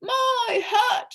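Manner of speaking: high, loud outraged cry; indignant shout. A narrator's reading. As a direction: protest the lost hat. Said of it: "shouted the bug".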